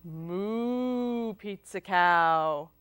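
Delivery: long, drawn-out moo; cow greeting. Acted. Child greeting a pizza cow, with slow mooing voice. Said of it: "What if it's a pizza cow?".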